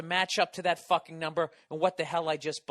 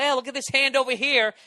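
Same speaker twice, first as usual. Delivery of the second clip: mock con man; 8 semitones higher, 7 dB louder than usual. Mocking corporate misdirection.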